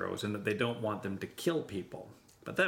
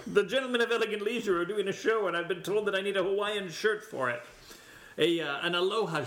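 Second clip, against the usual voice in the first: hammy stage actor; 6 semitones higher than usual. Acted. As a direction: play a pompous gentleman of leisure. Olde-timey gentleman shopping.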